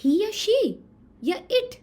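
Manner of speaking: high quiz. Shown as a demonstration. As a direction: ask it playfully. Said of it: "quizzing children".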